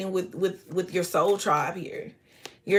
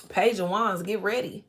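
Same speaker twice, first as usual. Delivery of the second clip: ordinary talk, nothing marked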